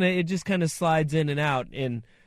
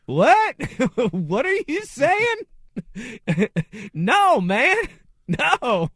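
high, loud yelling Southern voice, laughing through; Joe Dirt impression; 10 semitones higher, 4 dB louder, slower than usual. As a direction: squeal it out like a confused hillbilly. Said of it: "that scene in Joe Dirt".

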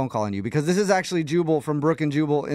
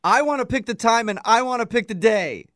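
put-on whiny kid voice, lightly higher; inner child voice; 7 semitones higher than usual. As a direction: play the petulant child within. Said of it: "Do you hear that voice saying those things?".

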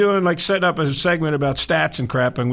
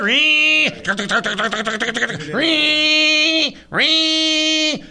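mimicked phone ring; 9 semitones higher, much slower than usual. Mock phone ringing bit.

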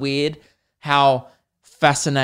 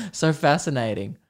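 mock fascination; 4 dB quieter than usual. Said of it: "I'm never like".

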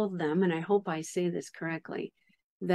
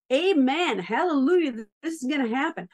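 louder, higher burst of joyful praise; excited exclamation; 6 semitones higher, 5 dB louder than usual. Retold or imitated. Host recalling her reaction to the prayer, excited and loud. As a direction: cry it out as if faith just clicked. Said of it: "And all of a sudden I go".

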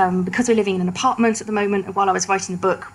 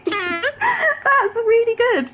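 laughing through the praise; slow laughing praise; 13 semitones higher, much slower than usual. Real, not acted.

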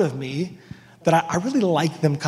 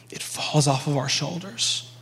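whisper; 3 semitones lower, 5 dB quieter than usual. Real, not acted. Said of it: "whispered line in sermon".

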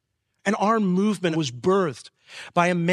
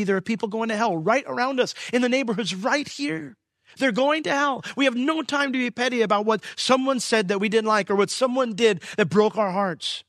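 higher, pleading preacher's warning; urgent preaching; 7 semitones higher than usual. Acted. Pastor warning his church about people going to hell, urgent.

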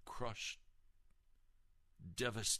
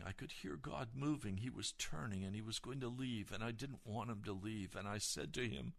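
ordinary talk, nothing marked

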